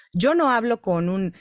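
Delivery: ordinary talk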